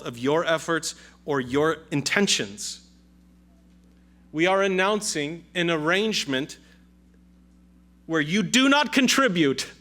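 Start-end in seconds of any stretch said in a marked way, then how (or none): 4.3-9.7 rising to a louder, higher proclamation; preaching build. Acted. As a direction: drive the point home from the pulpit. Preaching climax.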